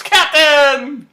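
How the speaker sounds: very high, full-volume shout; Eden. Retold or imitated. Eden cuts in as the promotion is announced, loud excited shout. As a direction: shout it out, pitch soaring. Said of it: "Eden shouts".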